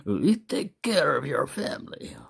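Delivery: breathy whispered threat; hammed-up menace; mock threat whisper. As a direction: whisper it like a gangster's warning.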